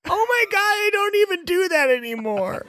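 high, wailing, tearful crier; crying town crier. Acted. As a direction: blubber like a bullied crier. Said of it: "stop crying, dude".

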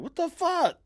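high-pitched, incredulous outburst; shocked disbelief. Retold or imitated. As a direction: react like you can't believe what you saw. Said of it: "I went like".

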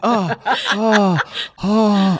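high shaky oh's; startled oh. Retold or imitated. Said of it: "I was like".

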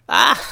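high, loud burst of cackling; long acted laugh, high and staccato; boy's hysterical laughter. A narrator's reading. Little boy laughs at pie-covered ninjas, hysterical laughter. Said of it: "laughed the boy".

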